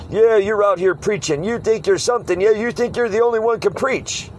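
imitating a high-pitched, taunting challenge; high, sneering mock tirade; in-your-face heckler. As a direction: get in his face with mock outrage. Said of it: "get right in my face, almost physical".

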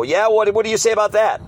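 imitating a heckler's high, pushy demand; mock confrontational outburst; yelling mocker. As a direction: pitch it up like a challenging heckler. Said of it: "They wanna yell and everything".

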